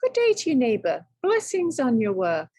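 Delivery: high, loud, overly polite hello; a cheery, falsely polite neighbour; polite greeting. Acted. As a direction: greet him with exaggerated courtesy. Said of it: "tipped his hat politely".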